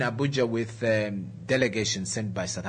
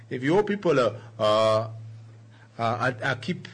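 put-on Nigerian accent. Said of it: "trying to get that, that, uh, Nigerian accent".

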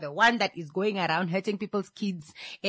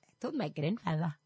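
low, very soft sly aside; acted hushed confession; knowing tattletale; 6 semitones lower, 13 dB quieter than usual. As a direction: confide it quietly and low. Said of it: "It's like".

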